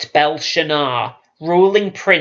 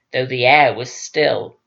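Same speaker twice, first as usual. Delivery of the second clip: plain reading voice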